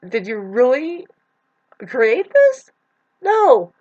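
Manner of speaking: high, loud disbelieving challenge; incredulous. Real, not acted. Asking listeners if they created it, loud incredulous scoff. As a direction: scoff at the very idea, voice jumping way up.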